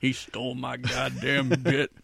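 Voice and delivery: slow, gruff-voiced aging actor. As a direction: drag it out like a grumpy old star. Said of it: "I could do a [name]".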